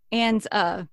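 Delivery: ordinary talk, nothing marked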